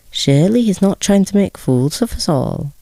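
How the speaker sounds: a hint of a puzzled official asking the parents; chief clerk. A narrator's reading. Chief clerk asks Gregor's parents, lightly voiced and puzzled.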